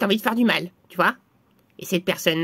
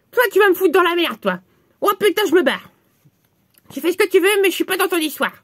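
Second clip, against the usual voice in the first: higher annoyed outburst; indignant protest; 6 semitones higher than usual. Acted. Friend refuses any part in the spell, indignant. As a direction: protest hotly and back out of it.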